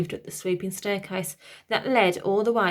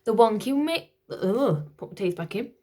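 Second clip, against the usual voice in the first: ordinary delivery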